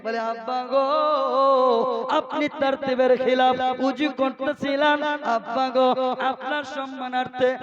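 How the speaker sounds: loud, chanting sermon delivery; preaching at full force. Acted. Preacher telling the crowd about Hasan and Husain, full-force preaching. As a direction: preach it to the whole gathering.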